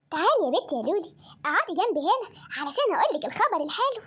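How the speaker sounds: a hint of a sweet-talking mother with news. Mother calls Loli over to share news, coaxing.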